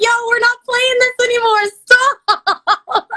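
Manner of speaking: loud, high yell, breaking into laughter; mock-outraged protest. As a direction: shout it down in playful outrage. Retold protest ending the baby-voice game.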